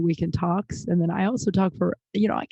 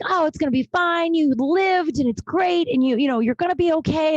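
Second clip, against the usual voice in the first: much higher, bright-eyed reassuring self-talk; pep-talk yourself in a high, put-on hopeful voice; upbeat self-reassurance; 9 semitones higher than usual. Quoting her own hopeful side, high put-on reassurance. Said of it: "some days I'm like".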